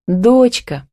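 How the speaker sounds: ДОЧКА lifted high, then dropping. A narrator's reading. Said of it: "прошептала Камила".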